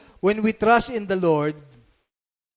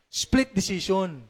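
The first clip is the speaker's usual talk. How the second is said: normal talking voice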